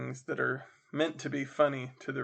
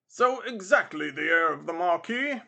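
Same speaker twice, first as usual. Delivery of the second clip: lightly voiced military gentleman; a touch of the officer in the voice; 3 semitones higher, 5 dB louder than usual.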